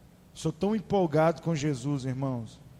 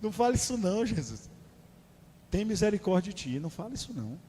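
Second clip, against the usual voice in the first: lightly voiced pleading Peter; 3 semitones higher than usual. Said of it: "vem Pedro e lá chama Jesus".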